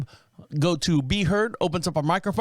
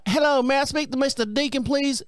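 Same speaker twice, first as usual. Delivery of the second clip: high, lightly voiced phone caller; country girl voice; 9 semitones higher than usual. Calling Deacon to book his appointment, lightly mimicked high voice. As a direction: mimic the scheduler's phone voice, a touch put on.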